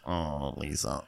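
ordinary talk, nothing marked

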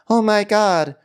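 high, loud gasping gossip voice; mock gossiping voice. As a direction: gasp it out like shocked gossip. Mock gossip voice about Meredith.

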